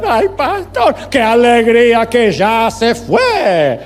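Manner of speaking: loud, drawn-out put-on voice; hammed-up funeral exchange. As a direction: stretch it out in exaggerated, high exclamation. Imitated funeral exchange with the widow.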